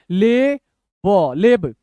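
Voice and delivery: very slow, drawn-out separate sounds; letter by letter. Shown as a demonstration. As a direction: sound out the two letters apart. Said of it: "तोड़ तोड़ के पढ़िए".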